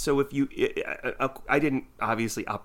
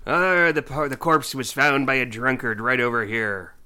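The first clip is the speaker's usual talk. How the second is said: put-on old-timey detective; 7 dB louder than usual. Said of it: "I love that you use the word drunkard".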